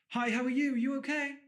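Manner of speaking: high-pitched, eager-to-please greeting. Retold or imitated. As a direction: imitate an over-friendly high voice. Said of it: "they will raise their tone".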